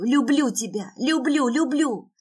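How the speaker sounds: high, desperate declarations; frantic, pleading cries; insistent repetition. Acted. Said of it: "повторяю".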